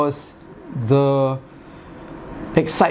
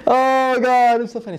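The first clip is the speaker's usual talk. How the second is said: recovering from laughter; 8 semitones higher than usual. Real, not acted. Getting over the funny film scene, spent amusement. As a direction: catch your breath after cracking up.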